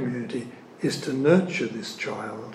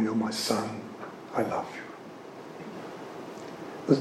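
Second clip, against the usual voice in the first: soft, hushed voicing of God's love; tender; 4 semitones lower, 11 dB quieter than usual. The Father softly telling his son he loves him. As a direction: say it gently, like a father to his child. God speaking to his child.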